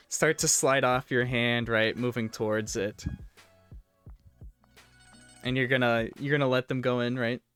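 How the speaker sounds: ordinary delivery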